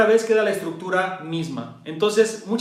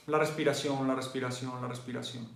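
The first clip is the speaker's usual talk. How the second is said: each repeat softer, down to a whisper; fading to a hush; 5 semitones lower, 10 dB quieter than usual. Shown as a demonstration. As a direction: let the voice sink away. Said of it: "repite bajando la voz". (cada vez más bajo hasta susurrar)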